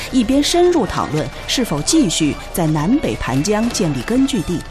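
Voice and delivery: ordinary talk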